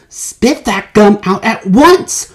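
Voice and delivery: stern father ordering. A narrator's reading. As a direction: order her sharply. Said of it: "ordered Mister Beauregard".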